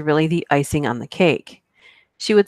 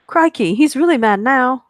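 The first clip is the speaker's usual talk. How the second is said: Aussie Crikey; 8 semitones higher, 4 dB louder than usual. Pretend. Mock Aussie exclamation to chat.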